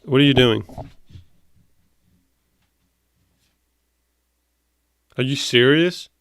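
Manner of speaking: annoyed, loud scolding of the pet; fed-up pet owner; exasperated scolding. Real, not acted. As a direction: tell off the dog in disbelief. Scolding dog interrupting video.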